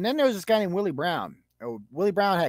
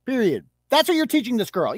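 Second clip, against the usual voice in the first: usual speaking voice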